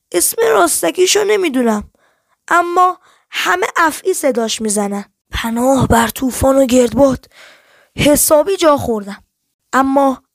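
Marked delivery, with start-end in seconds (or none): none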